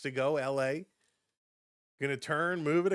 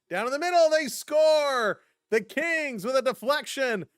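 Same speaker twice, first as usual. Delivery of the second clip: loud, high-pitched goal shout; play-by-play eruption, drawing out the big words; excited goal call; 8 semitones higher, 8 dB louder than usual. Real, not acted.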